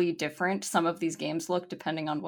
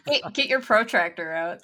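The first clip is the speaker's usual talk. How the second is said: ordinary talk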